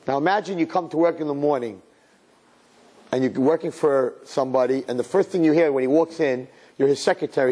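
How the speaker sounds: ordinary talk, nothing marked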